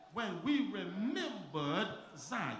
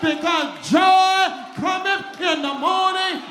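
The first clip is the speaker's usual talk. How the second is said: high, loud, sung-out preacher's climax; rising, chanting sermon cry of joy; preaching at full force; 7 semitones higher, 14 dB louder than usual. Acted.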